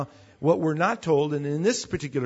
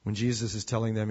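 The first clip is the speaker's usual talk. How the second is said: ordinary talk, nothing marked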